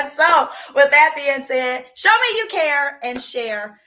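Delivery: rally the crowd to share; emphatic appeal. Real, not acted. Cheerleader host urging viewers to share the show, slow and loud.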